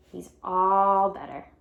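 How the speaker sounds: ordinary delivery, nothing marked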